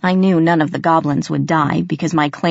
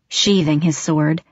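ordinary delivery, nothing marked

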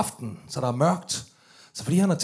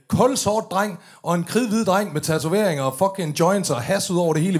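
normal talking voice

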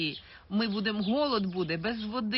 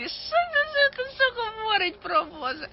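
mocking critics; 13 semitones higher, 5 dB louder than usual.

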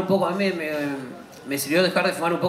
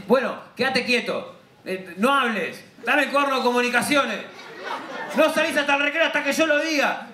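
bossy little teacher; 8 semitones higher than usual. Retold or imitated. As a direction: order him like a tiny schoolmistress. Niece playing strict teacher.

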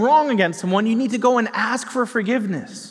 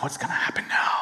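scared whisper; 6 dB quieter, faster than usual. Retold or imitated. Kid panicking about detention.

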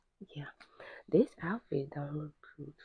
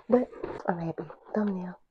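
whisper; 4 semitones higher than usual. Real, not acted. Quiet aside, thumbnail pose.

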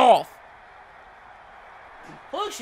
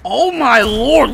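drawn-out amazement; 7 dB louder, much slower than usual. Real, not acted. Reacting to big run.

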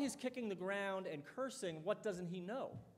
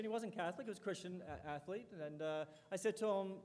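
ordinary talk, nothing marked

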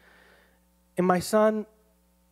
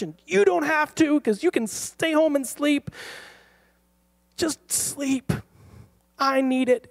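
high-pitched, escalating plea; desperate pleading; 9 semitones higher than usual. Retold or imitated. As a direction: beg him, climbing high with exasperation. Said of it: "pleading with him".

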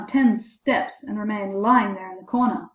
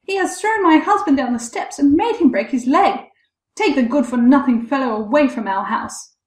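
high, loud outraged complaint; crying out loudly; 5 semitones higher, 6 dB louder than usual. A narrator's reading. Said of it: "with loud screams".

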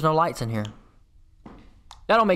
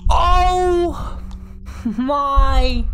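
long, high drawn-out wail; high exclamation broken by a laugh; overwhelmed cry; 10 semitones higher, 3 dB louder than usual. Real, not acted. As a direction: stretch it out in loud disbelief. Finally solves the code.

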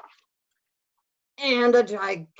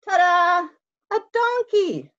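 loud, much higher grand ta-da; showman reveal; 13 semitones higher, 8 dB louder than usual. Acted. Fox unveiling the egg to Bear, loud and high. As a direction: unveil it like a magic trick.

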